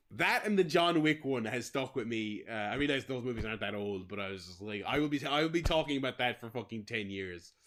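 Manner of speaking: ordinary delivery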